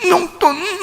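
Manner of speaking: fake-sob like a spoiled child begging for pocket money; high mock crying that dissolves into a laugh. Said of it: "막 눈물 질질 흘리면서".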